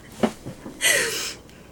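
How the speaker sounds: high-pitched burst of giggles; real laugh. Real, not acted. Cracking up after "not a music store", high giggle. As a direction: crack up at your own joke.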